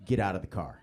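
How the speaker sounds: low, controlled cop order; stern cop. Retold or imitated. Cop ordering a passenger out, low imitated command. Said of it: "and says".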